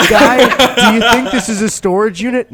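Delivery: mock practiced line. Postal worker rehearsing crossed-out phrases, mock exasperated delivery. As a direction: try out a slam dunk line in mock exasperation. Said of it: "whiteboard with crossed out phrases".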